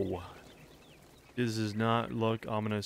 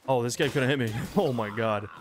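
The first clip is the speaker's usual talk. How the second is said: ordinary talk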